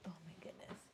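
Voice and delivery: hushed oh my goodness; whispered aside. Real, not acted. Owner whispering while her dog performs. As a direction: murmur it under your breath.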